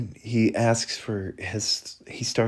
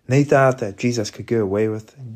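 ordinary talk

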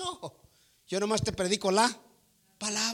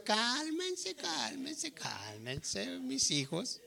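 put-on hushed, much softer, calming the children; soothing calm down; 13 dB quieter, much slower than usual. God calming his crying children, hushed and soothing. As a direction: settle them down gently, almost a whisper. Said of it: "El Señor diciendo".

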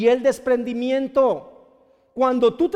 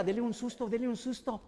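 much softer, hushed request; conspiratorial aside; 11 dB quieter than usual. Acted. Parent aside to policeman about the child, hushed. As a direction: ask it secretly, under the voice.

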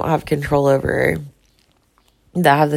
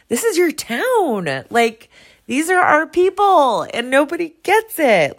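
high, slowed, pleading anger; impassioned plea; 11 semitones higher than usual. Real, not acted. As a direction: beg them to care, voice straining high. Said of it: "I'm just angry".

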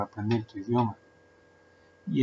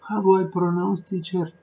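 mock student asking; 7 semitones higher, 4 dB louder than usual. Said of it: "yo seguía hablando así de".

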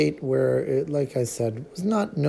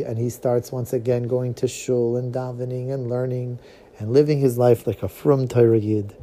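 ordinary talk, nothing marked

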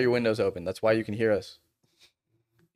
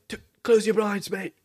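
lightly mocking Australian accent, raised pitch; 9 semitones higher than usual. Imitating the Australian neighbor about blinds. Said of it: "You're like".

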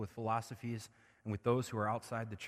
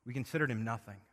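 usual speaking voice

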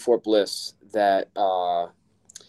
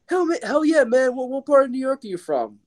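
excited cop. Retold or imitated. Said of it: "He's like".

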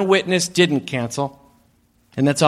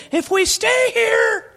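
high, slow shout; much slower than usual. Pretend. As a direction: yell it to the crowd. Shouted rally line.

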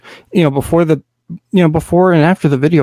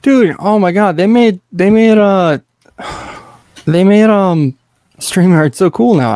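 excited; 6 semitones higher, slower than usual. Real, not acted. Thrilled by new stream tools.

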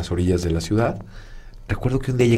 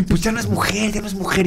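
much higher, insistent lady voice; housekeeper insisting; 10 semitones higher than usual. Retold or imitated. Housekeeper insists to Marcos, high and fretful. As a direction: pitch it up like a fretting older woman.

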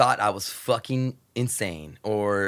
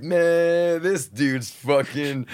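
imitating [name] guys; 4 semitones higher, 3 dB louder, much slower than usual. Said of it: "they were like".

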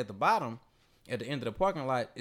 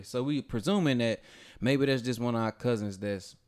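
usual speaking voice

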